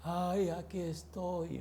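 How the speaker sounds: slow, faint, feeble voice of a depressed man; depressed friend. Retold or imitated. The depressed friend answers the pastor, faint and feeble. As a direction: sigh it out weakly.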